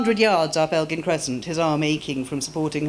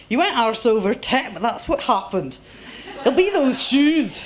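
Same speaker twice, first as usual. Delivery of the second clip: concerned Scottish man; 7 semitones higher than usual. Acted. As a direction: check on the fallen woman like a gruff kind Scot.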